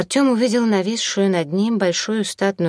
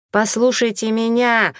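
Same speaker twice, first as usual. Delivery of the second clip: higher-pitched, moderately raised shout; 6 semitones higher, 4 dB louder than usual. A narrator's reading. Artyom demands to be heard by women, shouting. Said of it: "закричал он".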